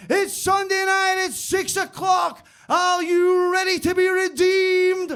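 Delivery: high, loud, stretched-out showbiz shout; Vegas show announcer. Acted. Workmate imagining the Pope's Las Vegas show, hyped announcer. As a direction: work the crowd with huge drawn-out words. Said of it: "[name] style Las Vegas show".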